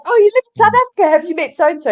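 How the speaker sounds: high, loud, quick mimicry of a clueless stranger; gushing small-talk question, hammed up; mock naive question. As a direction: imitate an eager, clueless questioner. Acting out people's naive question.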